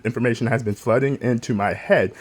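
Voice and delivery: ordinary delivery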